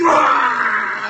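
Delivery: loud mock monster roar; sudden monster roar. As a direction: burst out roaring to startle. Heart suddenly attacks with roar.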